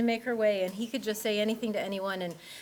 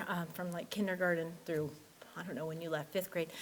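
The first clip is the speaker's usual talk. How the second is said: usual speaking voice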